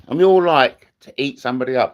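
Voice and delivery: ordinary talk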